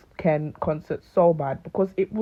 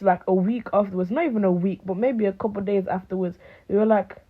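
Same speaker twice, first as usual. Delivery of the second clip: ordinary delivery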